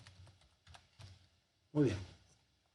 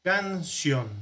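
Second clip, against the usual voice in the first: "Canción" pulled apart, higher pitched; syllables separated; 4 semitones higher than usual. Shown as a demonstration. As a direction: split "Canción" into its syllables, stretching each. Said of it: "vamos a poder separar". ("Canción" separado por sílabas, con tono más alto)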